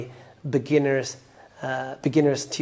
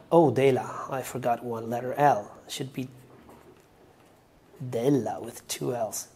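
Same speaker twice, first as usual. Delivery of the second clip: usual speaking voice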